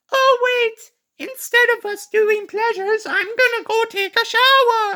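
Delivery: over-the-top high woman voice; mock woman voice. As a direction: squeak it out like a hammed-up movie seductress. Mocking the woman character's lines.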